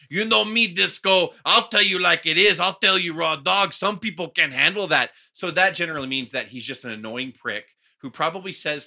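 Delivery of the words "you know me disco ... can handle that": loud, higher-pitched mock boasting; Conan impression. Podcaster mocking the wrestler's catchphrases, loud boastful impression. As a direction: imitate a blunt braggart at full volume. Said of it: "Conan will always, every other sentence is".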